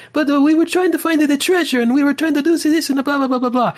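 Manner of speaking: high, excitable cartoon Italian accent; mock Italian accent. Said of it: "like, this Italian accent".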